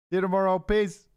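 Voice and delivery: high, loud parting shout; loud high farewell. Real, not acted. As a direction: call out goodbye cheerfully.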